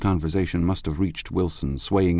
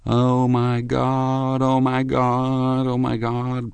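high, slow, drawn-out moan; high, horrible wailing call; 6 semitones higher, much slower than usual. A narrator's reading. Wilson, grieving in the garage, calls out incessantly, high wailing moan. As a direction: wail it on one held high pitch, over and over. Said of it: "he gave out incessantly his high, horrible call".

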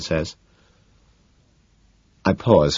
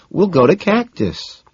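slow, high, breezy suggestion; cool; 13 semitones higher, 4 dB louder, slower than usual. A narrator's reading. Van Patten offers another restaurant, cool. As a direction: toss out the plan coolly. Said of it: "Van Patten says coolly".

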